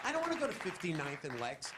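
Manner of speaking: ordinary talk, nothing marked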